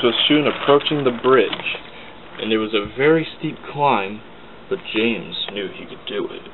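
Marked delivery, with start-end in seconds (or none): none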